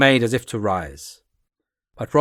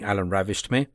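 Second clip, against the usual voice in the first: ordinary delivery, nothing marked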